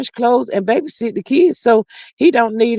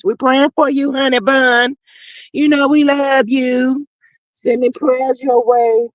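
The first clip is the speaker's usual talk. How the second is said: higher, drawn-out comforting tone; tender encouragement; 4 semitones higher than usual. Real, not acted. Streamer to ailing Miss Denise, tender and encouraging. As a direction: send love slowly, pitched up.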